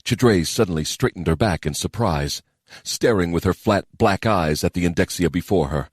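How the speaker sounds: ordinary delivery, nothing marked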